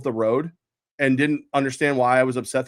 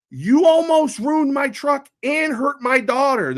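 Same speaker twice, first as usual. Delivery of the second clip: slow, high, louder scolding, every word spaced; retold scolding; 11 semitones higher, 4 dB louder, slower than usual. Father retelling scolding the driver slowly, high and angry. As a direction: drag it out, furious and deliberate.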